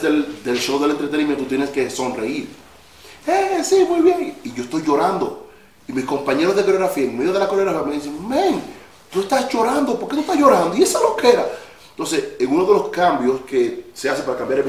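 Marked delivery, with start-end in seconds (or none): 3.3-4.4 much higher forced-bright performer reply; put-on happy stage voice; fake cheerful. Forced to smile while crying. 8.3-11.5 much higher, louder imitation of startled bandmates; mock the friends' bewildered teasing; astonished coworker voice; surprised friends. Dancers ask why he cries.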